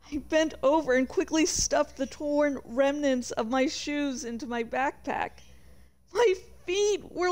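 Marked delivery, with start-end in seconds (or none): none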